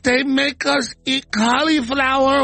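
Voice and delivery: high, slow, shouting mock cry; wailing mock AOC. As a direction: wail it like a hammed-up crybaby. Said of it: "A O C's like".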